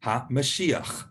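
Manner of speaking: Ha-Mashiach said slowly, ending in a throaty ch; Ha-Mashiach, stress on shi, final ch rasped. Shown as a demonstration. Teacher says Ha-Mashiach slowly for the class. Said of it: "the Messiah in Hebrew is Ha-Mashiach".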